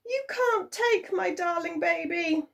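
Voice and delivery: high, pleading, desperate voice; high horrified pleading; horrified plea. A narrator's reading. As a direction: beg for your baby. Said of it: "cried Isabel in horror".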